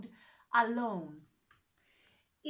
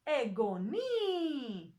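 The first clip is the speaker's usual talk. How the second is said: egoni with a long, rising-falling stressed last "ni"; stress on final syllable; much slower than usual. Shown as a demonstration. Greek teacher showing where the stress goes, stressed final syllable in egoni. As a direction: draw out and lift the end of egoni. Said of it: "if we put it here".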